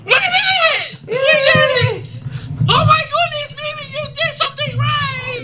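high, shrieking mock celebration; high-pitched hammed-up joy at finally getting it right; mock excited. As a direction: squeal in over-the-top delight.

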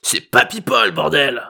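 high, cranky correction; irritated old man. A narrator's reading. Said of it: "s'énerva le vieux".

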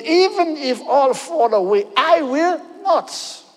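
high, slow defiant vow; proud, high-pitched declaration stretched out slow; Peter's proud boast. Acted. Preacher voicing Peter's boast.

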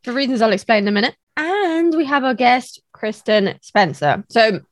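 strained, cracking voice; voice giving out. Real, not acted. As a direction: voice straining and breaking. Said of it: "I keep losing my voice and having coughing fits".

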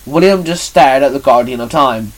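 ordinary delivery

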